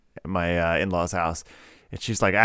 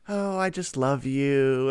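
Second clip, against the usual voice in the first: put-on slow, high sugary child voice; imitating a sweet child; 4 semitones higher, slower than usual. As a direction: play innocent and adoring. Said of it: "she's just like".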